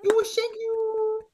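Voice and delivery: put-on very high cooing baby talk; squeaky high baby babble. Shown as a demonstration. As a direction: coo it like talking to an infant. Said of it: "You give me an example".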